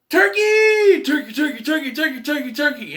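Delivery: high, loud frantic yelling, fading a little; imitated panicked yell. The dog yells as he runs from the turkey, high and loud. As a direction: shout the word over and over in alarm. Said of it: "you hear him yell out".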